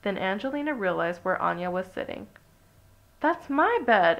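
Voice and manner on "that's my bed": high, loud possessive snap; sharp indignant objection; snapped. A narrator's reading. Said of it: "Angelina snapped".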